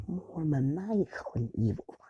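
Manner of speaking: slow, evil whisper; sinister whispery villain voice; maniacal villain. Retold or imitated. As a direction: whisper it with slow, creepy relish. Villain Shigaraki describes himself.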